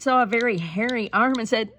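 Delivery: ordinary delivery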